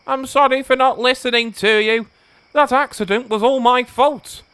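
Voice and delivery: high, earnest character voice; apology. A narrator's reading. James apologises to Edward in the shed, earnest.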